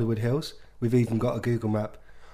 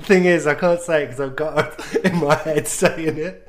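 fighting back giggles; 4 semitones higher, 5 dB louder than usual. Real, not acted. Can't say the accent for laughing, giggly. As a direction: talk through helpless giggling. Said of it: "[name]'s got the giggles".

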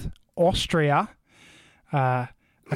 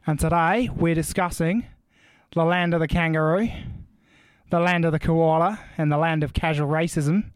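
hammed-up Aussie podcast intro; put-on Australian accent. Host welcoming listeners to an episode on Australia, in a mock Australian accent. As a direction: play the born-and-bred Australian host, accent laid on thick. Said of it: "that was your best accent you've ever done".

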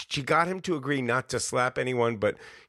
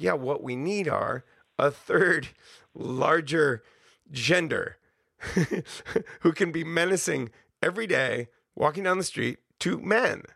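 male friend; 6 semitones higher than usual. Retold or imitated. Quoting a male friend at the bar, lightly voiced. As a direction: lightly voice the friend making a wry point. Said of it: "my male friend sitting at the bar with me was like".

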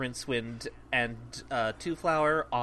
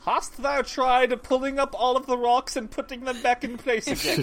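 mock old-timey druid; 8 semitones higher, 6 dB louder than usual. Mock archaic druid tech support.

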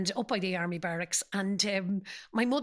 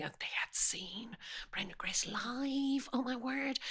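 awed whisper; 2 semitones higher, 7 dB quieter than usual. Real, not acted. Awed memory of her parents.

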